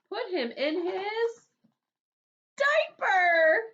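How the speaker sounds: high and rising to a loud reveal; dramatic build. A narrator's reading. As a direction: stretch it slowly, then burst out.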